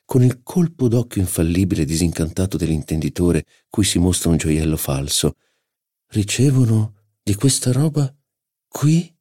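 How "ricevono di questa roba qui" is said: disdainful duchess. Acted. Duchess scorns a guest.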